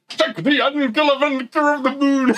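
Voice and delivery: high, exaggerated delivery of the line; over-the-top movie line. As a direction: ham up the line. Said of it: "It's the pronunciation of it as well".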